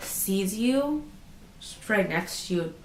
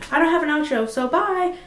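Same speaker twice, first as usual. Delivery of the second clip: high excited sign-off; 8 semitones higher, 5 dB louder than usual. Real, not acted. Abrupt laughing sign-off.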